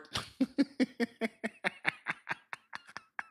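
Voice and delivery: high-pitched burst of laughter. Real, not acted. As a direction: let a giddy laugh rise up. Laughs at his own joke.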